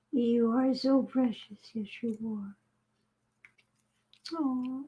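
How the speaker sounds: soft, slow cooing to a kitten. Real, not acted. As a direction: coo softly, almost whispering.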